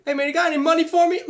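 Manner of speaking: high, fast mock beggar voice; imitating a panhandler. Homeless man voiced in anecdote.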